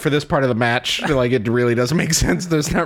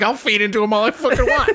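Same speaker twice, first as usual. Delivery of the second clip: fast, higher, laughing defiance; defiant mock retort; 7 semitones higher, faster than usual. Friend defying the peacemaker, mock defiant. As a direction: toss it back, cocky and quick. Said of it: "You're like".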